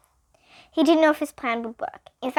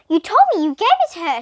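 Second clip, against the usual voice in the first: higher, louder and quick, pointing the finger; accusing; 6 semitones higher, 7 dB louder, faster than usual. A narrator's reading. Miss Jules accusing Calvin over the lost note, loud and quick. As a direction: snap the accusation fast. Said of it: "she accused".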